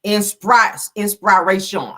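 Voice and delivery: loud "Inspire, inspiration" sounded out; mock misspelling. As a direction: read the typo aloud. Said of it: "see how she spelled inspiration".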